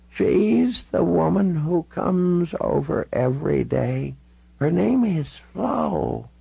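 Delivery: put-on high, slow, drawn-out elderly voice; old lady patiently setting someone straight; insistent old woman.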